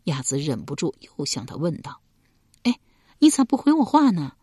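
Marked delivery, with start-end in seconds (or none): 2.6-4.4 high-pitched wifely complaint; higher, nagging character voice; insistent wife. A narrator's reading. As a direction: press him for an answer as the wife. Wife presses her silent husband.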